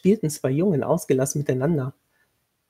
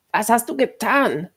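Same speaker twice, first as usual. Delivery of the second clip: high-pitched accusing cry; sudden scream at her; 7 semitones higher than usual. A narrator's reading. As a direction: burst out in accusation. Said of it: "schrie Adala sie plötzlich an". (plötzlich angeschrien)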